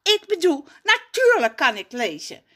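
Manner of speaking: put-on flustered boy. Raf flustered denial.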